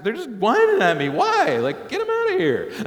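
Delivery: high, loud, hammed-up protest; exasperated complaint. Man wanting a whining kid removed, loudly exasperated. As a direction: climb to a mock-exasperated yell.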